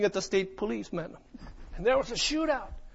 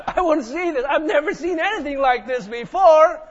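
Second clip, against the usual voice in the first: laughing; 5 semitones higher, 6 dB louder than usual. Real, not acted. Laughs at his own choice.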